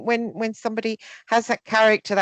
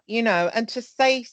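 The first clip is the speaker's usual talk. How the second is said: usual speaking voice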